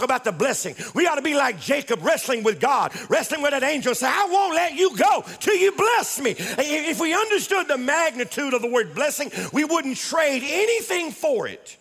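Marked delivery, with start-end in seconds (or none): 4.1-6.3 high, forceful refusal to let go; insistent plea. Acted. Preacher as Jacob wrestling the angel, insistent high plea. 6.4-11.8 high-pitched, slowing on the last clause; fervent preaching. Real, not acted. Preacher telling congregation what a blessing is worth, fervent. Preaching climax, repeated afterwards plainly.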